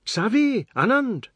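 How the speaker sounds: high, loud call of names. A narrator's reading. Shama calling her children away from Seth, calling out.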